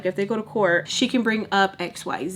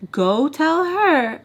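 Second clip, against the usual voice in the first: much higher and louder, slow and insistent; stern command; 7 semitones higher, 7 dB louder, much slower than usual. Retold or imitated. Baltimore ordering the nanny to fetch Sam, stern and loud. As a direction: bark the order down the stairs.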